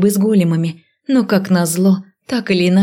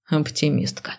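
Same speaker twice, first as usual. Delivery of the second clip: dry quiet remark; 3 semitones lower, 8 dB quieter than usual. A narrator's reading. Necromancer's dry reply.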